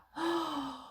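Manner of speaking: put-on a high, girlish gasp of wonder; a little girl gasping at herself in the mirror; delighted gasp. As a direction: catch your breath at your reflection. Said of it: "she exclaimed".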